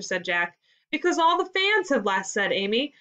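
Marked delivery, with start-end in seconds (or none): 0.9-2.3 high, pleased punchline; delighted with herself. A narrator's reading. Amy giving her riddle's answer, delighted with herself. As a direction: deliver it high, proud of your joke. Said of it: "clearly delighted with herself".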